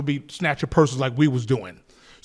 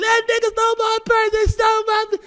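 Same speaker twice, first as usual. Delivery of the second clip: put-on old lady yelling. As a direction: shout it to the police as the robbed lady. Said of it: "and she yelled".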